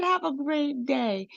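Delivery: high, slow, sugary "Have a great day"; singsong goodbye. Retold or imitated. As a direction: sing it out sweetly like a doting mom. Mom repeats goodbye, singsong.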